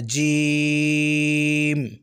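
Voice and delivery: जीम stretched very long, voiced inside the mouth; drawn-out jeem. Shown as a demonstration. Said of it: "जी मुंह के अंदर पढ़ना है".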